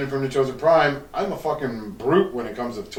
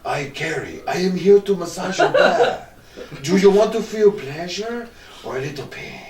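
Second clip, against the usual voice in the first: mock a Spanish masseur's accent; 5 semitones higher than usual. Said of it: "like, Spanish motherfucker coming in there".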